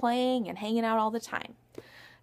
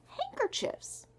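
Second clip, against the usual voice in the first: hushed reveal; 5 dB quieter, slower than usual. A narrator's reading. Big reveal to children.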